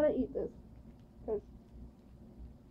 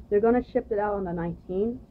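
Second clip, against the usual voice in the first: usual speaking voice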